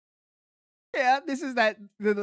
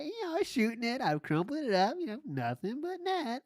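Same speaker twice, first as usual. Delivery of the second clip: higher, soft, casual mock boast; mock Michael Scott bragging; 6 semitones higher, 6 dB quieter than usual. Host mimicking the storyteller as sitcom boss, soft airy bragging. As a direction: toss it off with airy self-regard. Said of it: "he is Michael Scott, living".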